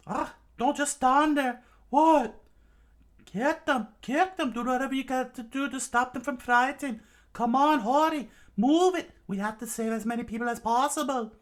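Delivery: high, loud falsetto orders; acted bossy girl shouting commands; urgent shouting. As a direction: yell frantically at the crew in a put-on high voice. Nami urgently orders the crew.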